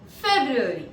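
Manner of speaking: FEBRUARY enunciated by itself, falling at the end; careful pronunciation demo. Shown as a demonstration. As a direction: model "February" clearly for learners. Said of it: "this is how you pronounce February".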